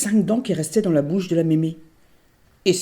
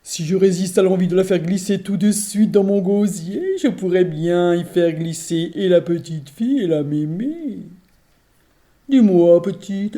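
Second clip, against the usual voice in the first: louder, gloating ogress voice; greedy ogress; 5 dB louder than usual. Acted. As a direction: savour the plan like a hungry ogress. L'ogresse rumine son plan. (voix d'ogresse gourmande)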